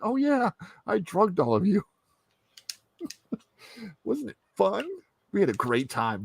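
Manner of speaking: high, giggly, unbothered; breezy confession. Acted. Deadpool admitting he drugged all of them, breezy confession. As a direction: own up as if it's delightful.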